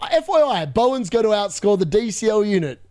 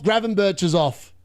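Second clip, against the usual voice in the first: ordinary talk, nothing marked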